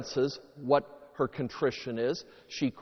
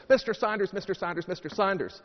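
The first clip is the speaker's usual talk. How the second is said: higher-pitched, insistent repeated call; eager pupil calling; 5 semitones higher, faster than usual. Acted. Pupil with hand raised to the teacher, eagerly calling.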